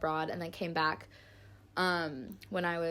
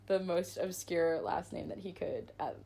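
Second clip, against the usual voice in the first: ordinary delivery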